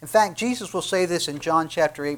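ordinary talk, nothing marked

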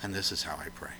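hushed whisper, no voice at all; breathy private whisper. Acted. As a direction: whisper it like a secret prayer. Jesus shown praying privately.

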